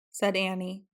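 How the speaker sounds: ordinary delivery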